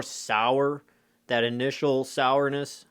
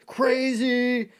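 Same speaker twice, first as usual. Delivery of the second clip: mock excited kid; 9 semitones higher, much slower than usual. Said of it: "you may be like".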